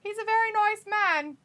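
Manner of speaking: high, posh-pretend old lady, laughing at the end; mock Queen voice. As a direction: ham up the Queen approving of a friend. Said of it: "And she said".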